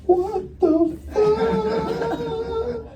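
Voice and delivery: very high, very slow wailing what the fuck; very high slow disbelieving wail; drawn-out shriek. Acted. Drawn-out shocked outburst.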